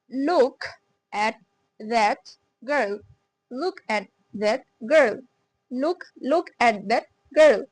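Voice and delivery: very slow, word by word; slow drill. Shown as a demonstration. Teacher drilling short oo sentence, very slow. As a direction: drag the sentence out for learners. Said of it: "এটা হচ্ছে উ, short".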